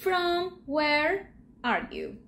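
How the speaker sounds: word by word, slowly; slow literal gloss. Shown as a demonstration. Teacher glossing the question slowly for students. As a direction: spell out the literal sense. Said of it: "more like".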